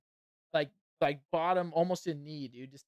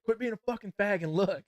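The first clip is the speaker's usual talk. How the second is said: imitating Chris, louder and higher, pushy; retold loud demand; 5 semitones higher, 5 dB louder than usual. Chris urging a reluctant friend in the locker room, mock-loud demand.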